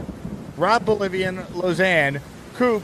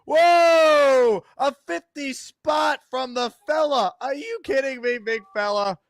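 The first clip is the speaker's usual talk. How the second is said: excited shout; 7 semitones higher, slower than usual. Real, not acted. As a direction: shout it out in disbelief. Host sees huge gift arrive.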